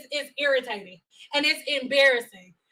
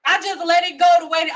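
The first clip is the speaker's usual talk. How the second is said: normal talking voice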